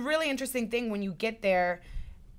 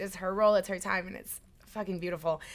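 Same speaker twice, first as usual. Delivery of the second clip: ordinary talk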